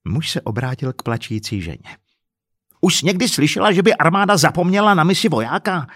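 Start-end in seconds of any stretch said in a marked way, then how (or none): 2.8-5.9 loud, high incredulous question; heated, scornful interrogation; angry challenge. Acted. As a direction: confront her, demanding an answer. Husband challenges crying woman.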